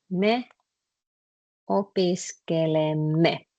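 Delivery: slow, syllable by syllable; slow demo. Shown as a demonstration. Teacher spelling out "opiskelemme" slowly for the class. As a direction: lay out each syllable slowly for students. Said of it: "you put it like this".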